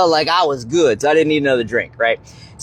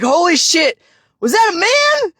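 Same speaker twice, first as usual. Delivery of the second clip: much higher, shocked realization; shocked disbelief; 12 semitones higher than usual. Retold or imitated. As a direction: gasp it out as it dawns on you. Stunned after being picked up.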